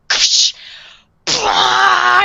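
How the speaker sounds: stabbing noise, then loud high burst; mock spurting scream sound effect; imitated gory scream. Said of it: "He starts screaming".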